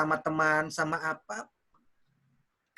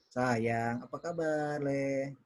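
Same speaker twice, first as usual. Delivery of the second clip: low, soft, tender greeting; lingering endearment in a lowered, softer voice; talking to wife; 4 semitones lower, 7 dB quieter, much slower than usual. Shown as a demonstration. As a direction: demonstrate greeting a wife affectionately. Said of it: "ngomong ke istri".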